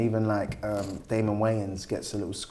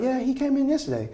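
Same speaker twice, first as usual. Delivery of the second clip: higher, lightly voiced woman; 13 semitones higher than usual. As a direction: play the gallery woman, a bit higher. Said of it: "she's like".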